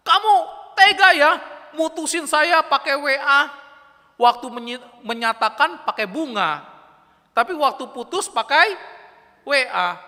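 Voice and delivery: high, loud hurt accusation; shrill-free loud reproach, pitched high; angry girlfriend. Retold or imitated. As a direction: scold him like a dumped girlfriend. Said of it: "yang cewek marah kan".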